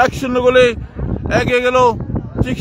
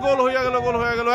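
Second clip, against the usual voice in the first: goal celebration call; 2 semitones higher than usual. Real, not acted.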